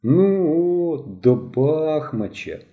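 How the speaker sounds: put-on drawn out, let-down sigh of a boy; disappointed drawl. A narrator's reading. Mishko let down by the offer, slow disappointed drawl. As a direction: stretch it out, deflated. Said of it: "розчаровано протягнув Мишко".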